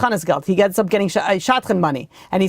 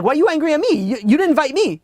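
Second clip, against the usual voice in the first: higher-pitched retold protest; indignant disbelief; 8 semitones higher than usual. Friend answering his angry friend about the wedding, indignant disbelief. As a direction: throw the accusation back, mock-affronted. Acted quarrel over missing invitation.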